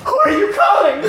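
high, hollered haunt-character taunt; taunting shout. Retold or imitated. Haunt actor harasses man with phone, taunting shout. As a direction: call out to needle the victim.

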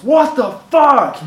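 high, drawn-out mock outrage; outraged group reaction. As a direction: round on the clumsy newcomer, slowly and incredulously. Everybody turns on the clumsy newcomer.

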